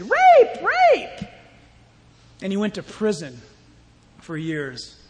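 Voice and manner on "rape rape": high-pitched acted shriek, then dropping off; mock cry of accusation. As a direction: cry out like the scorned wife. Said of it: "she said".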